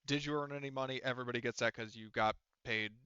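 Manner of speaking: normal talking voice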